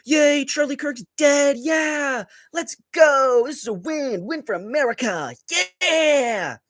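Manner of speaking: high mocking yell of triumph; mock gloating cheer. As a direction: ham up a gloating fan cheering a death. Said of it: "that's going".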